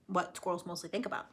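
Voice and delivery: plain reading voice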